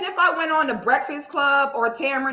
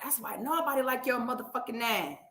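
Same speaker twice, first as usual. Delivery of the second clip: imitating her muttered dig; imitated insult; 10 dB quieter than usual. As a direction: quote her jab softly, drawing out the end. Quoting a woman's insult.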